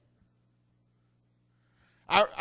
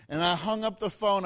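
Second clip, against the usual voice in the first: normal talking voice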